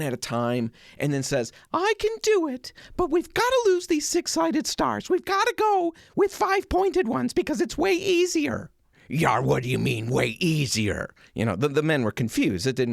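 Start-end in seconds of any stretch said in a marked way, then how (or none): 1.7-8.7 put-on very high falsetto young woman; a confident seamstress setting the men straight; Betsy Ross voice. Betsy telling the revolutionaries how to make the stars, high falsetto. 9.1-11.1 put-on puzzled pirate-accent voice; a confused revolutionary talking like a pirate. Revolutionary puzzled by Betsy's suggestion, pirate accent. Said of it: "I don't know if that was his real accent".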